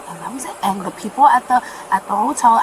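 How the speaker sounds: ordinary talk, nothing marked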